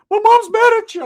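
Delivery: very high, loud put-on voice; alarmed cousin imitated. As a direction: squeal it as the panicky cousin. Cousin acted out.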